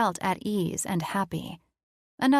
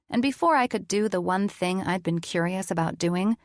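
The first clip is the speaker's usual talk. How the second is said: plain reading voice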